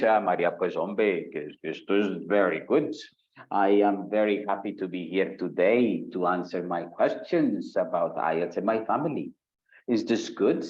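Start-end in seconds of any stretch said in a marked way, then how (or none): 0.0-9.3 English in a strong Paisa accent. Shown as a demonstration. Teacher asking if an accent hurts exam scores, demonstrated Paisa accent. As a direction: lay on a Paisa accent from Colombia. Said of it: "considering the fact that I am Paisa".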